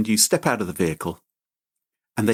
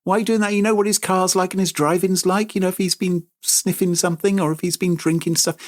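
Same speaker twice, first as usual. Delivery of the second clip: higher, a mock scolding parent; imitating furious parents; 7 semitones higher than usual. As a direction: scold like an annoyed mum, half in jest. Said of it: "They're gonna be furious with you".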